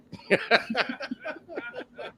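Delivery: real high, drawn-out laugh. Real, not acted. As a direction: burst out laughing at the memory. Laughs at own story.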